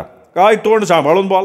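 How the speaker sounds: high, mocking echo of his words; angry mocking echo. Shopkeeper mocking Datta's warning, angry echo. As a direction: throw his words back at him angrily. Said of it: "शेठ त्याच्यावर तावदारला".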